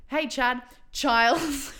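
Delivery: high, loud mock hello; blurted greeting. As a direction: call out cheerily, then blurt the correction. Retold blurted greeting with wrong name.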